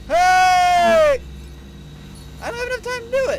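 high, fast, loud panicked yell. Real, not acted. Player panicking at the timer, yelling high and fast.

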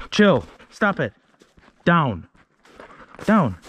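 loud, sharp orders to the dog; stern dog commands. Real, not acted.

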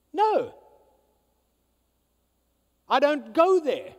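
loud, high, fervent preaching; preaching at full force. Acted. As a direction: build it to a shouting climax.

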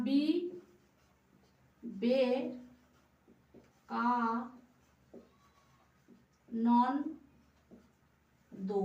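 বিবেকানন্দ stretched out, each syllable held apart; very slow syllables. Shown as a demonstration. Teacher dictating বিবেকানন্দ syllable by syllable for writing. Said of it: "তাহলে কি লিখবো".

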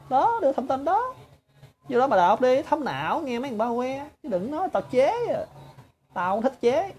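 a scornful, sing-song taunt; high taunting jeer. Real, not acted. Speaker taunting his online critics, high and jeering.

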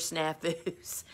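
normal talking voice

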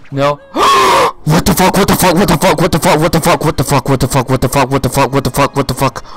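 gasp, then rapid-fire loud repetition; shocked disbelief. Real, not acted. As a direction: blurt it over and over in real shock, settling gradually. Shock at the surprise reveal.

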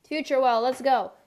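ordinary delivery

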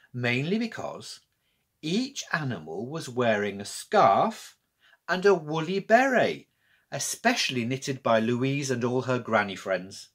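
ordinary delivery

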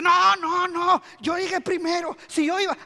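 high, urgent player's protest. Acted. Runner arguing with the umpire, protesting.

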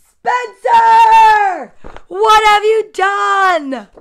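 drawn-out, high, loud shout; furious mother shouting. Acted. Mother finds toys all over the floor, furious shout. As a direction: yell it slowly like an outraged mom.

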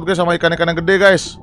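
normal talking voice

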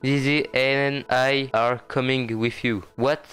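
put-on slow, measured reading in French-accented English. Gamer reads Zizi's cutscene dialogue aloud, French-accented English. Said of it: "Magnifique accent anglais".

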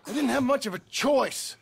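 defensive. Acted.

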